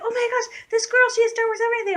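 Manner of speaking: high, fast breathless-news squeal; freaking-out young fans. Retold or imitated. Girls telling an adult woman, high excited gushing. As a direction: imitate excited girls reporting an amazing find. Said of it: "all the girls are freaking out".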